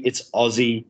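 Aussie, middle sound buzzed as z. Shown as a demonstration. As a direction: say Aussie as "Ozzie", s voiced like z. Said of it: "The S's are Z's".

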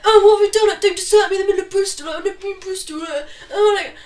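high, loud mock-desperate boyfriend; shouting boyfriend. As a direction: shout after her through the street. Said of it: "shouting about holding your hand".

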